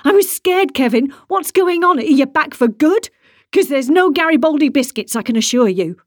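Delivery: high, loud and distraught; tearful wife shouting at her husband; upset shouting. A narrator's reading. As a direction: pour out the fear as anger. Wife berates returned husband.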